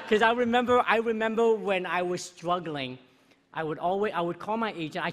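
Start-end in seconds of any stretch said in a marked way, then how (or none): 0.1-3.0 louder, higher, then slowing on struggling; emotional recall. Real, not acted. Winner recalling his struggling days, emotional.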